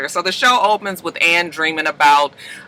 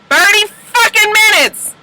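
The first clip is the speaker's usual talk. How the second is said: high, loud exasperated yell; real angry outburst over the wasted half hour; frustrated shout; 8 dB louder than usual. Real, not acted. Venting over the lost recording.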